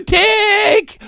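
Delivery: sharp high shouted tick. Acted. Ranter mimics the clock, shouting. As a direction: spit out the tick.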